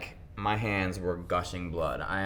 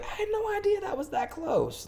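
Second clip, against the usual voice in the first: mock surprise.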